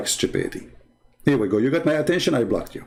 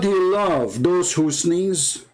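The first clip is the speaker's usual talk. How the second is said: usual speaking voice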